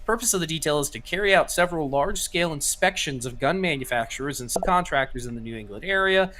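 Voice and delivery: steady read-aloud of a news report. Acted. Co-host reads a leaked ATF story to the stream, newsy read. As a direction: read the article out flat and clear.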